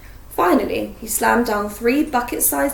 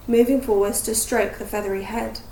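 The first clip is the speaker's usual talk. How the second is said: ordinary delivery, nothing marked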